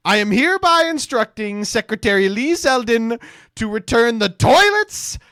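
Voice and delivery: very loud, high-pitched mock decree; hammed-up, blaring official proclamation; Donald Trump. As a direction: shout it like an all-caps post. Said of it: "Like, this is Trump".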